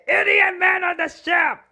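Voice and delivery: loud, high-pitched griping; exasperated complaint. Acted. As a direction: shout it in mock exasperation. Girls' night complaint, voiced.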